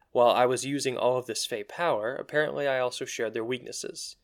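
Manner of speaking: usual speaking voice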